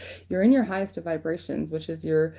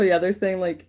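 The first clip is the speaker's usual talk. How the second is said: ordinary talk, nothing marked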